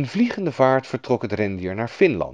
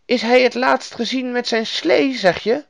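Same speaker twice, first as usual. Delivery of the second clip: high-pitched storybook woman; curious woman character, voice well up; Lapland woman; 13 semitones higher than usual. A narrator's reading. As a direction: question the child as a helpful stranger. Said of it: "vroeg de vrouw".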